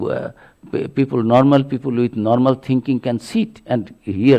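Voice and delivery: normal talking voice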